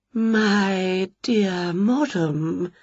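very slow, soft trembling spook; a delicate poetic ghost, trembling; quivering ghost. Acted. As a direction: float each word out slowly. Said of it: "in a quivering voice".

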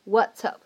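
what's up run together as wassup; linked. Shown as a demonstration. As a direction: link what's up into one word. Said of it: "可以做连读".

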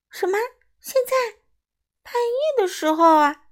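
very high, loud disbelief; indignant cry. A narrator's reading. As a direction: squeal in protest like a little bat. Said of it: "莱尼不满地叫起来". (不满地尖声叫起来)

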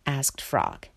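usual speaking voice

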